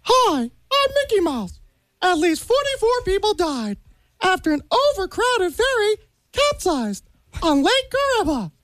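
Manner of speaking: cartoon mouse reading grim news; Mickey Mouse. Guest reads a ferry disaster to hosts, as a cartoon mouse. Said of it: "when Mickey Mouse says it".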